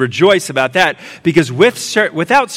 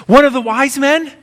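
mom guessing; 8 semitones higher than usual. Retold or imitated. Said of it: "she says".

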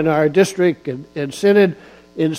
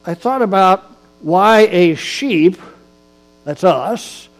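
louder emphatic preaching with punched words; 3 semitones higher, 4 dB louder than usual. Acted. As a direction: drive each phrase home to the pews. Preaches on Psalm 23.

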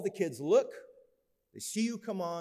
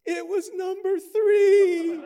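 put-on high, loud, slow wailing kid voice; mock crying daughter on the phone; imitating sobbing child; 12 dB louder, slower than usual. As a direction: wail it like a heartbroken child. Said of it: "she's weeping".

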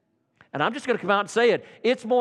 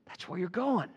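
hushed, sober repetition; quiet echo; 9 dB quieter than usual. Real, not acted. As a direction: let it sink in softly.

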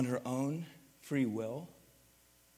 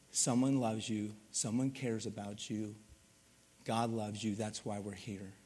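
tender reassurance; 5 semitones lower, 8 dB quieter than usual. Retold or imitated. Message the old lady heard.

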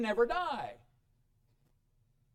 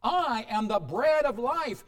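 slow, higher and louder, stress on "bread"; emphatic repeat; 5 semitones higher, 5 dB louder, slower than usual. Shown as a demonstration. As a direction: lean hard on "bread" and slow the whole line down. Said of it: "He's repeating this over and over and over again for emphasis".

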